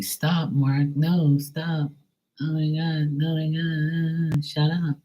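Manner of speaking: high, whiny mock of the neighbor; imagined whiny neighbor. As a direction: parody her nagging him in a high voice. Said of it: "I can imagine her saying that to me".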